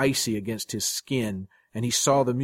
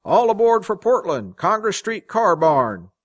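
high, loud trolley announcement; conductor's yell; 9 semitones higher, 8 dB louder than usual. A narrator's reading. Conductor calling passengers to board, loud yell. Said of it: "the conductor yelled".

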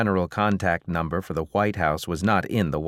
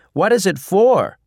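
shouted reporter question; 12 semitones higher, 9 dB louder than usual. A narrator's reading. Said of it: "the first reporter asked".

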